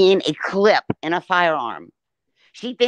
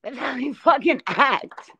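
higher, lightly voiced gossipy country woman; a hint of a scandalized country gal; mocking her critics' gossip with a light country twang; mock country girl; 5 semitones higher than usual. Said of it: "Where did this country, country, uh, girl come from?".

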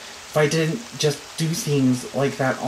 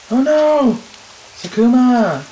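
gasp, then a high loud cry of alarm; 9 semitones higher, 7 dB louder, slower than usual. Real, not acted. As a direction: cry out in shock for Sakuma. Finds friend collapsed.

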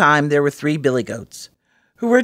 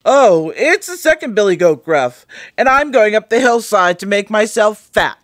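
middle goat voice; 6 semitones higher, 5 dB louder than usual. Acted. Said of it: "who hadn't such a small voice".

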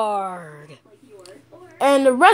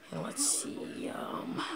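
whispering; 4 semitones lower, 21 dB quieter, slower than usual. Real, not acted. Trailing off, searching for words.

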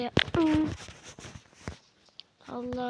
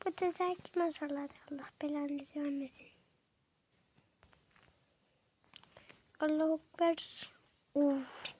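lightly raised, put-on girlish voice. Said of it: "beş dakikadır kız sesi yapıyordum". (hafifçe inceltilmiş kız sesi taklidi)

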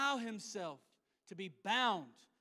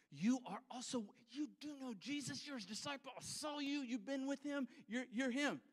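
higher, pressing voice, piling on accusations; insistent bystander; 4 semitones higher than usual. Acted. Bystander presses Peter that he saw him with Jesus, higher and insistent. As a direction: corner him with each line.

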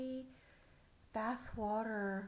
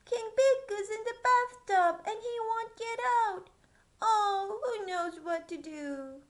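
high loud worried cry; panicked page; 9 semitones higher, 9 dB louder than usual. Acted. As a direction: wail it like a frantic page. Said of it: "cried the page".

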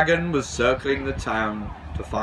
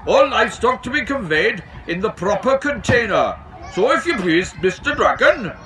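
high, raised voice laying down the rules; officious station master; 9 semitones higher, 4 dB louder than usual. A narrator's reading. Station master tells the dragon about livestock rules, officious high voice. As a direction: insist on the regulations, pitched up and firm.

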